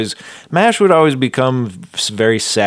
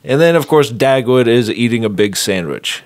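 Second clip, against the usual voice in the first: ordinary talk, nothing marked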